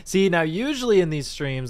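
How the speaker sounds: normal talking voice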